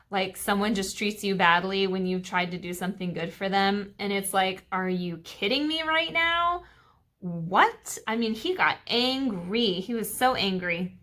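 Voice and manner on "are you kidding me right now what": high, exasperated "are you kidding me"; exasperated disbelief. Retold or imitated. Student treated badly after helping, high and exasperated. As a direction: sound fed up and incredulous. Voicing wronged reaction.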